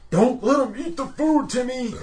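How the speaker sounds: high, loud urgent warning from beyond; ghostly grandpa warning. Retold or imitated. Retelling the movie, grandpa warns Timmy in a high loud voice. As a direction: call out like a frantic ghost grandpa.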